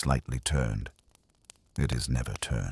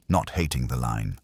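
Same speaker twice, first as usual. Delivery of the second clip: ordinary delivery, nothing marked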